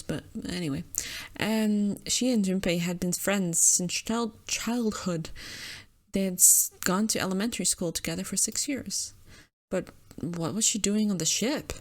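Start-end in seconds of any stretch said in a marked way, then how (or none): none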